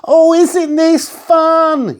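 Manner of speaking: put-on high, slow, thrilled girl's call; excited yell. A narrator's reading. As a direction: shout with delight while whizzing. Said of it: "yelled Katie".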